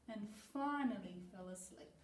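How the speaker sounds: ordinary delivery, nothing marked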